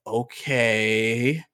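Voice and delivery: drawn-out, stunned acknowledgement; stunned mom. Retold or imitated. Mom answers the hungover trainer, stunned. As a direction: imitate someone lost for words. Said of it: "my mom was just in so much of shock".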